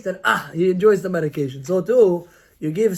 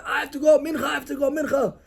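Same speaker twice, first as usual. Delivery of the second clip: whiny excuse; 7 semitones higher, 4 dB quieter than usual. Retold or imitated. Child whining excuse to mom.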